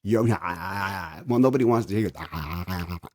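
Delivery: mock choppy ah sounds; mocking epiglottis slaps. Mocking a bad singer on the mic with choppy ahs. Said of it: "hear your epiglottis slap each other".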